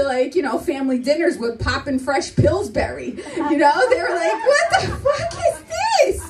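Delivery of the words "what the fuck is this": high, drawn-out mock shout; acted high-pitched indignation; outraged relatives. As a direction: imitate appalled parents yelling. Family's outraged reaction to store dough.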